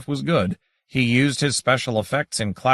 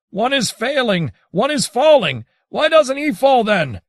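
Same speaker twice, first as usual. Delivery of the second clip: much higher, louder alarmed shouting; frantic cry; 12 semitones higher, 5 dB louder than usual. A narrator's reading. Onlooker watching Adrian fail, frantic high shouting. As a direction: call out in alarm as he fails.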